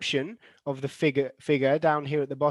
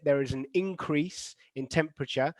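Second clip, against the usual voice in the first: teacher's model of IN-crease, the noun "increase"; stress on first syllable. Shown as a demonstration. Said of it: "it is the first syllable".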